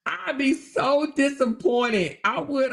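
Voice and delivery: high, slow wail through laughter; mock-disappointed, cracking up; amused mock disappointment. Real, not acted. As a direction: stretch it out, laughing at the letdown. Laughing over imagined letdown.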